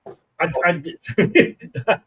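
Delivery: ordinary delivery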